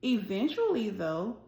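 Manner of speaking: gentle, expressive storybook reading; reading to children. A narrator's reading. Teacher reading a picture book aloud to her class, expressive storytelling. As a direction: read it like story time for little kids.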